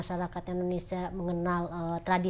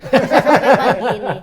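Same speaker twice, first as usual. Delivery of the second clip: laughing; 5 semitones higher, 15 dB louder than usual. Real, not acted. Joking it could last till morning.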